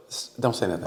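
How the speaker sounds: hushed, low warning from the poll worker; quiet voiced poll worker. Poll worker quietly warning him at the booth, hushed. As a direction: drop low and hush them. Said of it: "So she goes".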